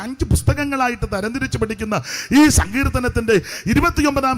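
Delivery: emphatic, rhythmic pulpit delivery; preaching. Real, not acted. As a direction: preach it out to the congregation, phrase by phrase. (ഊന്നിപ്പറയുന്ന സുവിശേഷ പ്രസംഗം)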